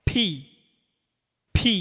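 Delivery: ফি said with both lips pressed together, then released; ফি, with the lips folded and popped open; ফি as a bilabial sound, lips folded together. Shown as a demonstration. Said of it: "দুই ঠোঁট ভাঁজ করে উচ্চারণ করিতে হয়".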